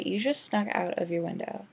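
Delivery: ordinary delivery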